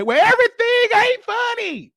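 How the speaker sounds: very high, put-on whiny objection; mock complainer. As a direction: mimic a naysayer way up high. Mimics people who complain.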